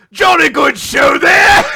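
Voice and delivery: high, loud hammed-up British accent; mock posh British shout. Imagining riders calling dragons, hammed-up British accent shouting. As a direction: yell it like a silly posh Brit. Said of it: "to command your dragon".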